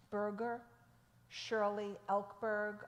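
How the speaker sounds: normal talking voice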